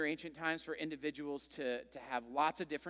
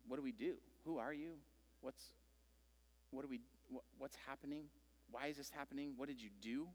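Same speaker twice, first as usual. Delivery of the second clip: hushed panic; 3 semitones lower, 14 dB quieter, faster than usual. Retold or imitated. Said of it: "And they're like".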